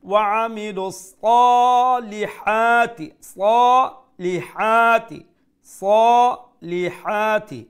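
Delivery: high, loud, slow chant with long সা and হা in সালিহাতি; long madd recitation. Shown as a demonstration. Teacher drilling students, loud sustained chant of সালিহাতি. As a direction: stretch both a vowels of সালিহাতি, li short. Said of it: "এক আলিফ লম্বা".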